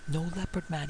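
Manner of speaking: ordinary delivery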